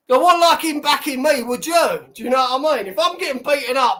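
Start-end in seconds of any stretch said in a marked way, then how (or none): none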